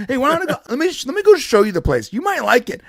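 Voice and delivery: high-pitched, suddenly helpful salesman act; changed tune. Retold or imitated. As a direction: switch to an eager mock sales pitch, voice up. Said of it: "I changed my tune".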